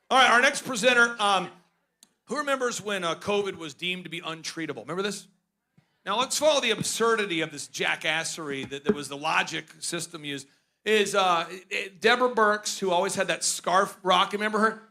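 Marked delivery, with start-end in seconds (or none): none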